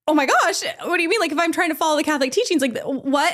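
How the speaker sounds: high, fast panicked babble; retold panic to the church women; mock panic. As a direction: freak out in a high rush. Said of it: "I start freaking out".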